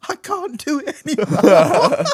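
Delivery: loud, slow, laughing cry of defeat; hammed-up plea to stop; mock exhausted wail. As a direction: wail it as a worn-out dad, half laughing. Retells dad exhausted on broomstick.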